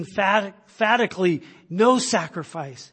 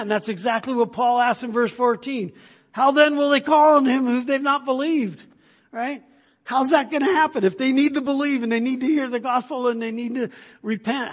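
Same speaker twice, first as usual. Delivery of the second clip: loud, driving pulpit preaching, pitched up; preaching at full force; 7 semitones higher, 7 dB louder than usual. Acted. A pastor preaching hard to his congregation, loud and driving. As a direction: preach it out to the whole room. Preaching through Romans ten.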